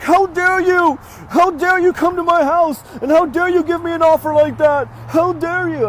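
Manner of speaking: high, loud mock outrage; chewing him out. Upset seller calls back the guy who looked at the property, indignant mock yelling. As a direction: imitate an indignant, high-pitched tirade. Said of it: "chewed him out".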